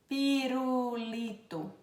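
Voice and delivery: "Pirulito" slowly, syllables stretched; drawn-out example word. Shown as a demonstration. Teacher giving an L example, "Pirulito" drawn out. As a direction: sound out "Pirulito" at half speed. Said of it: "O próximo é a letra L".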